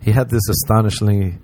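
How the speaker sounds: usual speaking voice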